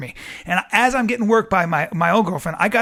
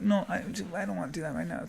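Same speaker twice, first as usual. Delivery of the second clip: hushed refusal; 10 dB quieter, faster than usual. Retold or imitated. Woman's silent refusal.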